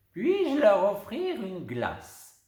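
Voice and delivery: offering a treat; kindly grandmother. Acted. Grandmother offers ice cream. (grand-mère aimable, lente)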